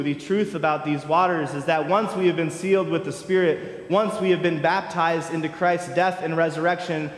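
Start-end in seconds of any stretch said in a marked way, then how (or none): none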